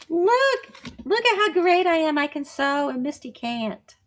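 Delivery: put-on high, bragging little-girl voice; bragging doll voice. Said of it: "She's a bragger".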